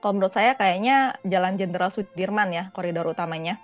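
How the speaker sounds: ordinary talk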